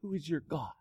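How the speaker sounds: the question repeated softly to the congregation; quiet repeat. Acted. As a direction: let it sink in quietly. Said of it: "and said".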